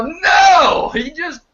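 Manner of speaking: high-pitched outraged protest, opening on a shout; indignant yelling. Retold or imitated. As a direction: yell back at the TV in outrage. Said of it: "And I'd go".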